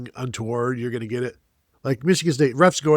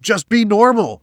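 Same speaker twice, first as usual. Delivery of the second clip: a sudden loud, high, desperate cry of just be normal; Hereditary meme yell; 8 semitones higher, 8 dB louder than usual. Retold or imitated. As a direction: belt it like the horror movie meme. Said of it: "the hereditary meme".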